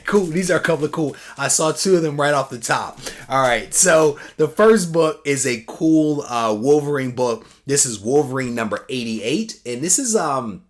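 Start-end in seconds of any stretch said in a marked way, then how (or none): none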